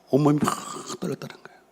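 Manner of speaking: 떨렸다는 fading into a breathy whisper; trembling whisper. Shown as a demonstration. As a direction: let 떨렸다는 거예요 die away to a whisper. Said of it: "이 히브리 언어예요".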